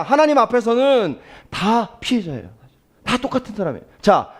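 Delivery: normal talking voice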